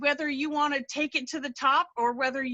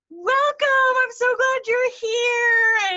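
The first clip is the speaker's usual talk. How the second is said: high, loud, drawn-out squealed greeting; gushing group welcome; 12 semitones higher, 7 dB louder, slower than usual. Retold or imitated. New artist imitating gals welcoming her, squealing with excitement. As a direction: imitate a crowd of excited women, stretching the words. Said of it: "all the gals in there were like".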